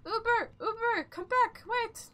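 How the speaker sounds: desperate loud calling; shouting. Real, not acted. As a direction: yell for the ride to come back. Calling after the ride again.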